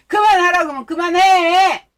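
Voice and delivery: loud, high scolding; exasperated shout. Real, not acted. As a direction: snap at him to stop already. Scolding viewer who keeps gifting.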